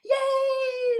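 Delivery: high, drawn-out cheer; imitated whoop of celebration; mock crowd cheer.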